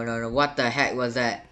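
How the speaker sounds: ordinary talk, nothing marked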